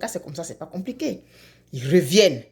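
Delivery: plain reading voice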